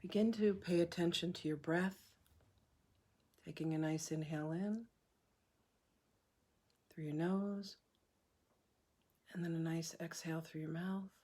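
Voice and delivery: soft, unhurried meditation voice with long pauses; hushed, calm breathwork leader; guided meditation. Acted. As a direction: guide them gently through each breath, leaving room to breathe.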